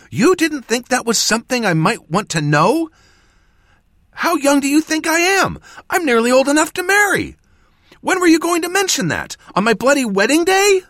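very high, loud, outraged tirade; acted fury, building in pitch; fuming. A narrator's reading. Apprentice berating mentor about marriage ban, fuming. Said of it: "Jas fumed".

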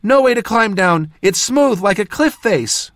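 high, urgent shouted report; shouting back. A narrator's reading. Jas reports the sheer drop to the mage, shouting back. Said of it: "Jas shouted back".

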